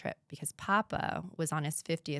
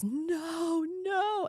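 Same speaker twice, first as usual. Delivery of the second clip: no, no cried out much higher in anguish; a pitched-up wail of disbelief; anguished cry of no; 12 semitones higher than usual. Retold or imitated. Hearing that her parents died.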